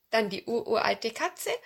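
ordinary delivery

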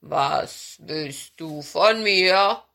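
slow, loud bear voice; drawn-out bear rumble; growling bear. Acted. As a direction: ask it as a lumbering bear. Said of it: "brummte er".

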